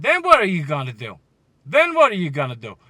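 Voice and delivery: shouted, hammering question; loud challenge. Real, not acted. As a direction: demand an answer at full voice. Ranting challenge about the team's plan.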